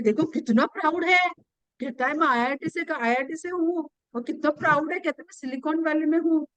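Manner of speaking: fast, sneering aside about a show-off; mock jealous whisper-talk, quick and sneering; mocking gossip. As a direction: rattle it off like a snide gossip. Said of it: "does that mean that person is saying".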